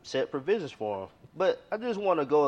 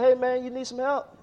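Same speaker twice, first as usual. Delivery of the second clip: higher, louder call across the water; 10 semitones higher, 5 dB louder than usual. Acted. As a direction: hail the drowning man from a boat. Speedboat man calls out.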